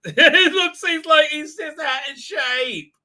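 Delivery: high, loud and slow through real laughter; laughing. Real, not acted. Mocking the old actor's shape, laughing hard. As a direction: crack up while mocking.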